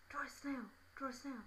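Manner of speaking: lightly put-on pestering boy; insistent schoolboy. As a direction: imitate a boy quietly nagging. Acts boy repeating his request.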